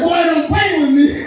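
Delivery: high, loud mock warning. Wife warns her husband not to play with her, mock warning. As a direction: act out a wife's sharp warning, drawn out.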